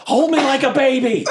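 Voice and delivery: high yell with a laugh in it; comic yell. Acted. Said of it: "I just yell".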